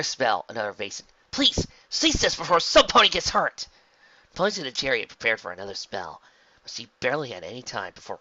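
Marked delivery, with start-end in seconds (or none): 1.3-3.7 slow, loud, earnest plea; pleading shout. A narrator's reading. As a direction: beg them to stop, high and loud. Pleading with attackers mid-fight.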